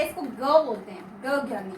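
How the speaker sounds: "ग ज्ञानी" said the school way, as gya; wrong school pronunciation. Shown as a demonstration. Teacher shows students the wrong way, low demo voice. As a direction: demo the common gya sound for ग. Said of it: "लेकिन ये उच्चारण गलत होता है".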